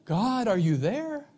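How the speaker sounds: high plea without the shouting; searching question. Acted. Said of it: "begins with these questions".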